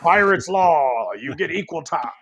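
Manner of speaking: slow, high gruff pirate drawl. Retold or imitated. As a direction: growl it out like a pirate captain. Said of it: "trying to do my pirate voice".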